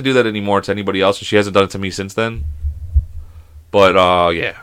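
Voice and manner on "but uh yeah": usual speaking voice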